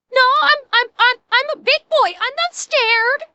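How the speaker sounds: high, stammering scared-little-boy imitation; mock terrified. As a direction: pretend to be brave while badly frightened. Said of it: "he's fucking terrified".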